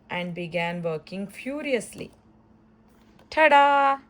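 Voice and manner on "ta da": high, loud showman reveal; triumphant ta-da. Acted. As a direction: present it with a flourish. Reveals her paper sculpture.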